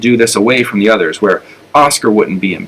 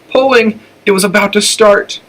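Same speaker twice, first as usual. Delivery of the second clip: much higher, giddy excitement; thrilled; 11 semitones higher than usual. A narrator's reading. Flora inwardly thrilled that pulling will start, giddy. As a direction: she can barely contain herself.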